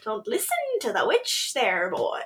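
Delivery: hammed-up Irish brogue; put-on Irish accent. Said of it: "But he does it with an accent".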